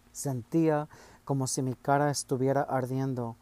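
ordinary delivery

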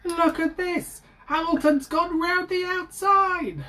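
very high, excited race-commentator shout; Murray Walker commentary. Retold or imitated. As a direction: scream it like a frantic F1 commentator calling an overtake. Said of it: "Just Murray Walker commentating".

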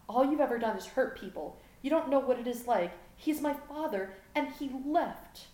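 high, anguished outburst; hurt teenager shouting accusations, rising in pitch; angry accusation. A narrator's reading. Gohan accuses Vegeta in a quarrel.

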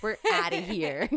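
loud, high, laughing announcement; triumphant laughing exit. Retold or imitated. Retelling leaving the clinic with him, loud and laughing. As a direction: burst out with gleeful finality.

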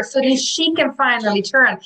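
ordinary talk

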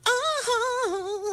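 squeaky high imitation; high mock uh-huh. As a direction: imitate her high and light. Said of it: "she's like".

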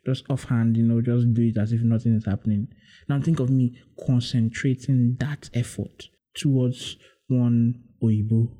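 ordinary delivery

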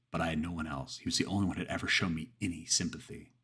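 usual speaking voice

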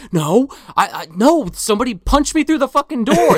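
panicked protest. Retold or imitated.

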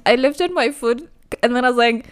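ordinary talk

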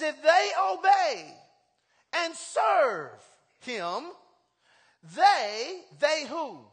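fiery pulpit reading; preaching at full force. Acted. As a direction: proclaim the verse to the whole church. Call-and-response preaching, shouting.

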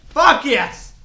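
high, very loud whoop; explosive excited yell; triumphant cheer. Real, not acted. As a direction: shout it in celebration. Celebrating something just seen.

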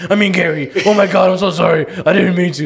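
hammed-up panicky sorry; flustered Satan.

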